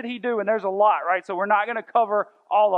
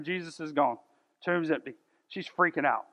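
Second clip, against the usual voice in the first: ordinary delivery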